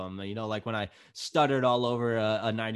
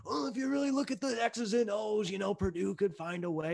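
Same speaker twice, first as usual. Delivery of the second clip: know-it-all analyst; 8 semitones higher, 3 dB quieter than usual. Retold or imitated. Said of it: "that guy that's like".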